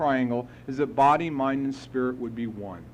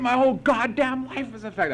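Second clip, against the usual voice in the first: high, indignant protest; retold belligerent outburst; 9 semitones higher, 3 dB louder than usual. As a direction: fire it back defiantly. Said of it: "I was so belligerent".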